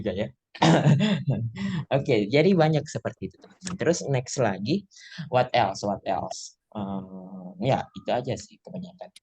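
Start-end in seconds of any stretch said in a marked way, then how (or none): none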